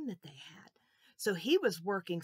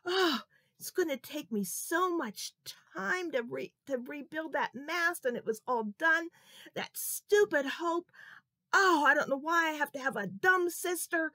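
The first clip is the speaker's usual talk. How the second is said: higher, louder angry grumbling; boiling mad; 8 semitones higher, 7 dB louder than usual. Acted. Johnny fuming to himself about rebuilding the mast, angry. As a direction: rant about the stupid sister. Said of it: "He just was boiling mad".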